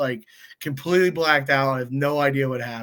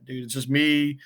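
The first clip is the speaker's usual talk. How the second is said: ordinary delivery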